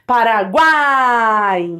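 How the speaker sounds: PARAGUAI with a long, slow GUAI falling away; triphthong demo. Shown as a demonstration. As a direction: draw out the final glide of PARAGUAI in one syllable. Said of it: "vogal e semivogal de novo".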